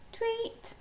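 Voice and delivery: high-pitched little bird squawk; squawked tweet. A narrator's reading. Daisy squawks at the birds by the bird table, squawking. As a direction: chirp it out high. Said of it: "squawked Daisy".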